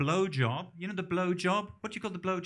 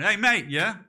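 threatening hey mate; 4 semitones higher, 7 dB louder than usual. Retold or imitated. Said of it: "if somebody went".

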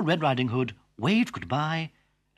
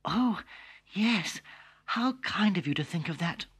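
put-on sweet little girl; 7 semitones higher than usual.

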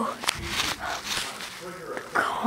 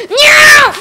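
high, loud cat cry; screeched meow; 13 semitones higher, 6 dB louder than usual. Pretend. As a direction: shriek a meow. Imitating the upset cat.

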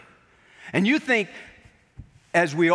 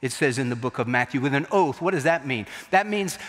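ordinary talk, nothing marked

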